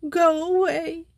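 high, tearful plea to be left alone; sobbing. Acted. Simone sobs at Joey to go away. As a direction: push her friend off through tears. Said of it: "sobbed Simone in her own language".

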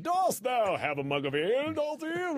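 high, hammed-up medieval performer; frustrated Renaissance Fair actor. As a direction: accost them like an overacting fair player. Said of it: "a bunch of frustrated actors came at me going".

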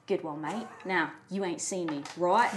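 low, softened conspiratorial voice; a secretive word to the boy; furtive aside. Acted. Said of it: "I'm just gonna pat him".

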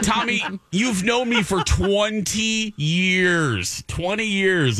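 high, slow mock disbelief; slow high-pitched plea to an old colleague; incredulous plea. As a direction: stretch it out, amazed he didn't know you.

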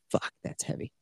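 whispered under the breath; hushed whisper. Retold or imitated. Gamer reacting to a sad game scene, hushed whisper. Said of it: "I was like".

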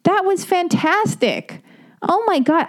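high and drawn out, slow; delighted. Real, not acted. After finishing Sam's email, slow delighted praise. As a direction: savour it, thrilled.

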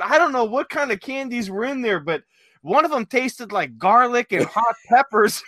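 lightly voiced coworker griping about the candy; coworker complaining. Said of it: "I had one guy going".

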